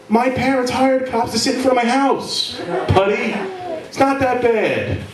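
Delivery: high, smug spoiled voice; smug rich kid. Retold or imitated.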